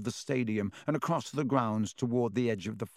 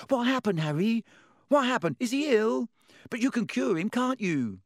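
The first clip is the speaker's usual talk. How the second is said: put-on a much higher, breathless young voice; eager boy; 10 semitones higher than usual. Colin chasing Harry, eager and high-pitched. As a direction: pester him like an excited fan.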